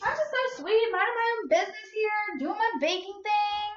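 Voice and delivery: high, sugary innocent voice; mock innocent. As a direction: play the sweet little baker. Playing innocent sweet baker.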